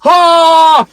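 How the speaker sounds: loud, high mock exclamation; excited gasp. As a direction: burst out as the prediction comes true. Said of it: "I was even like".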